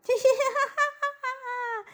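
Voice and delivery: long squealing high laugh. Acted. Joker after the lunchroom punchline, squealing laugh. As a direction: crack up in a high-pitched fit.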